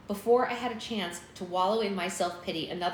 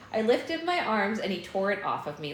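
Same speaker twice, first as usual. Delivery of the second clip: usual speaking voice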